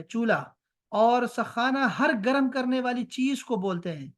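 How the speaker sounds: normal talking voice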